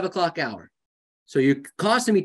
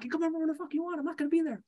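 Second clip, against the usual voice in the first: mock dismissive retort; 10 semitones higher, 9 dB quieter, much faster than usual. Snapping back at visiting family.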